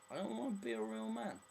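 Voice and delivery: high, loud mock whine; comic high-pitched refusal. Rejecting the Neanderthal standard, comic high-pitched refusal. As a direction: push it up high for a joking protest.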